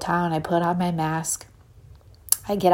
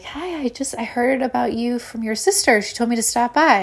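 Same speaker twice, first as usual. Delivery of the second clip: higher, tentative friendly voice; retold meek, higher-pitched greeting; polite newcomer; 5 semitones higher than usual. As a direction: introduce yourself eagerly to a cool stranger. Her nervous friendly reply, acted out.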